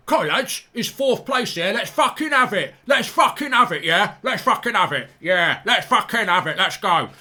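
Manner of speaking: loud, high hammed-up captain's rallying cry; mock team talk. Mocking Kane's All or Nothing team speech, hammed up. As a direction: pump up the lads, overdone. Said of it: "get your arms round each other and just go".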